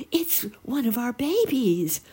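high, thrilled little sparrow; excited cry. A narrator's reading. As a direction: burst out in high-pitched delight. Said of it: "cried the shy little sparrow excitedly".

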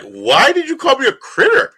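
high, rising, indignant question; retold bafflement. Cohost replaying his reaction to the critter remark, high and indignant. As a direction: act out your own confusion from moments ago. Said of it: "I was sitting here like".

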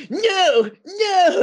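very high panicked no; high nervous kid protest; Butters voice. Retold or imitated. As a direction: squeak no no like Butters. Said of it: "And then Butters like".

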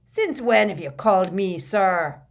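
loud, indignant question; booming disbelief; stunned challenge. A narrator's reading. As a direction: demand it loudly, taken aback. Stunned Hagrid challenges Harry.

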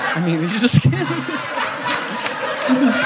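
words squeezed out through laughter; trying to talk while still cracking up; laughing. Real, not acted. Preacher to congregation after a ringtone, talking through laughter.

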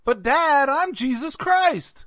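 put-on high, loud kid's whine to dad; drawn-out high protest, laugh creeping in; protesting son. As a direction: plead the punchline like a wronged child. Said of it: "He goes".

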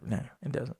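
soft unvoiced whisper. Real, not acted. Podcaster whispering his answer to listeners. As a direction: breathe it as a hushed aside.

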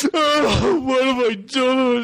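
hammed-up wail, high and drawn out; exaggerated tearful howl, played for laughs; mock crying. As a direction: imitate a blubbering guy. Imitates Angelo crying on show.

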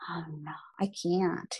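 soft, breathless whisper; whispered no to friends; whispered refusal. Retold or imitated. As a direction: shrink away and whisper it.

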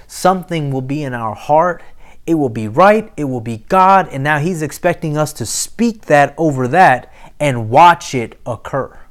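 usual speaking voice

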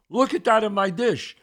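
high, loud mock outrage; retold freak-out. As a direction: complain like an appalled diner. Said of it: "He's freaking out".

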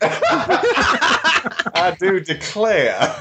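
very slow, high, hammed-up Southern belle drawl; Southern belle accent. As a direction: stretch it out like a fainting Southern lady. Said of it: "in a southern accent".

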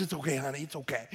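soft, low reassurance; quietly calm your wife down; soothing husband. Acted. Husband soothes his insulted wife.

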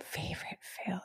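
breathy whisper, thinking aloud; a private whisper while pondering; whispered to herself. Real, not acted. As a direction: murmur the words under your breath. Repeating the question to herself.

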